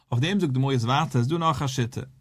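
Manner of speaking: usual speaking voice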